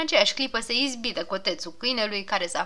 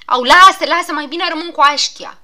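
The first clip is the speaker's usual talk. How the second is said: higher, much louder begging to stop; loud pleading; 7 semitones higher, 12 dB louder than usual. Acted. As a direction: beg him to leave the splinter in. Patient begging doctor stop.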